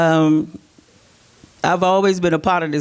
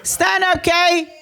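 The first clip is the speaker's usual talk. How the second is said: high call across the room; high excited call; 10 semitones higher, much slower than usual. Real, not acted. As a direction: call her up from the crowd. Calling out to sister in crowd.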